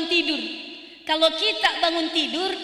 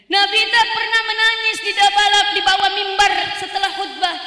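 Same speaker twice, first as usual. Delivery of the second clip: higher, louder rising sermon cry; preaching at full force; 5 semitones higher, 6 dB louder than usual. Acted. The preacher proclaims to the congregation at full force. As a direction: build it up and shout it to the hall.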